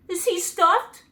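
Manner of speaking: be caught off guard; surprised. A narrator's reading. The lion asks Dorothy about the Scarecrow, surprised. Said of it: "asked the lion in surprise".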